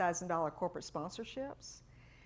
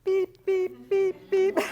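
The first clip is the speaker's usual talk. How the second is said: high, loud, electronic wrong-answer beeps; mock buzzer beeps; 13 semitones higher, 11 dB louder, much slower than usual. Consultant mocks her own miss with high loud buzzer beeps. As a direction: beep like a game show buzzer. Buzzer after dealer's verdict.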